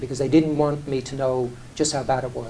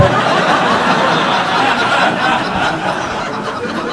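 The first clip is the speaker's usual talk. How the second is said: big breathy laugh; 9 semitones higher, 5 dB louder than usual. Real, not acted. Laughing hard while telling interviewer the joke story. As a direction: burst out laughing at the memory.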